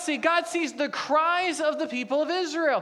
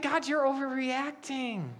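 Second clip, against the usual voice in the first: higher, softer, pleading disbelief; protesting to God; 5 semitones higher, 3 dB quieter than usual. Retold or imitated. Reader arguing with God about scared Moses, mock pleading. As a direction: plead with God, half joking. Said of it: "I read that, I think".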